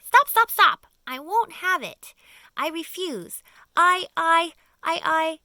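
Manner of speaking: high, loud, stammering panic; panicked refusal. A narrator's reading. Earthworm refusing to be bait, stammering in panic. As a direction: sputter in terror.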